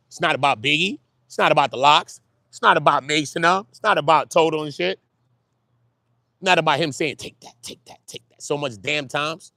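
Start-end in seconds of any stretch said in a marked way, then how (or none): 0.1-7.1 loud, insistent repetition; emphatic. Real, not acted. Repeated emphatic list. 7.1-8.4 whispered ad-lib imitation. Quoting [name] ad-lib.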